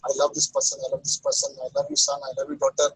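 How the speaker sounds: high, soft, sighing I love this person, a lightly hammed lover; mock doting voice. Teacher mimics a clinging lover, high and soft. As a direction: gush it softly like someone smitten.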